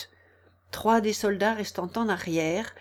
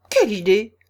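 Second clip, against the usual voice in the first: firm loud retort; loud, decided; 8 dB louder than usual. A narrator's reading. Alice cutting off the Queen, loud and decided. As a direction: say it very loudly and decisively. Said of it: "très haut et d'un ton décidé".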